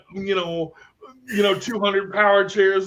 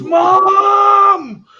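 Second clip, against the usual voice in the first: kind roept luid en hoog; kid yelling for mom; 12 semitones higher, 10 dB louder than usual. Retold or imitated. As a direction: roep luid en hoog naar mama. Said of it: "He goes".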